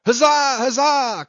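high, loud huzzas; sailors' cheer. A narrator's reading. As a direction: cheer as a rousing crew. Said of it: "cried the seamen".

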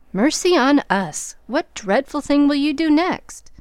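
breathless mock horror; dismayed exclamation. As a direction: throw up your hands in horror. Jo shocked by the marriage.

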